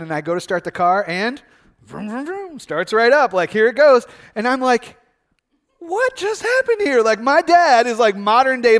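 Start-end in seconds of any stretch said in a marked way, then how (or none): 1.8-2.6 high, soft mouth-made vrooms; playful engine noise; imitated engine revving. Imitates the car starting. 5.8-7.0 very high, drawn-out retold astonishment; comic high-pitched amazement; amazed disbelief. Retold amazement as car starts.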